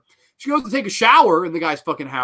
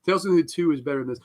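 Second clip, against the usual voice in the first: usual speaking voice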